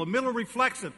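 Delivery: ordinary talk, nothing marked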